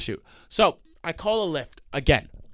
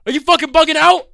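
high, loud furious shout; yelling at driver who won't let him in; 9 dB louder than usual. Retold or imitated. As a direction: scream at the driver. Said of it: "I'm yell-, I'm mad at this guy".

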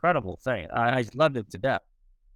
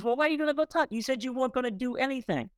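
wife's puzzled voice; 10 semitones higher, faster than usual. Retold or imitated. Wife Debbie questions him, retold.

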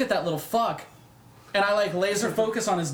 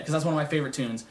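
ordinary delivery

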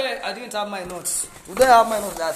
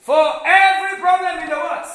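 loud, raised preacher's voice; emphatic preaching; 7 semitones higher, 10 dB louder than usual. Real, not acted. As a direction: proclaim it forcefully to the congregation. Preacher repeats the key point loudly.

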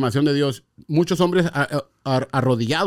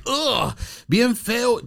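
high, drawn-out, mock-disgusted "Ugh, bien feo"; disgusted groan; 8 semitones higher, much slower than usual. Man telling a friend how sin felt, in mock disgust. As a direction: stretch it out as if smelling something foul.

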